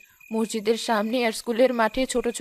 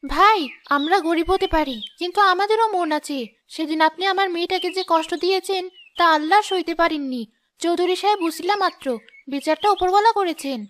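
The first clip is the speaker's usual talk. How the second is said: wounded reproach; 7 semitones higher than usual. Acted. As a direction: make him feel the harm he did to her daughter. Poor woman reproaches the shopkeeper.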